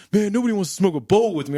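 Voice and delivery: imitating a sad stoner, fast; whiny stoner guy. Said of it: "And he's like".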